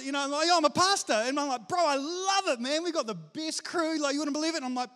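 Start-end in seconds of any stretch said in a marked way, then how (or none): 0.5-1.1 higher, louder proud announcement; fired up. Acted. Pastor telling surfers his job, fired up. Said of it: "now I'm fired up". 1.7-4.6 high-pitched, gushing enthusiasm; fired up. Acted. Pastor raving to surfers about his church, fired up. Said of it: "now I'm fired up".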